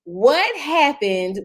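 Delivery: normal talking voice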